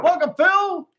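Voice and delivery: high excited greeting; excited welcome. Acted. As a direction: welcome him brightly. Greeting the new guest.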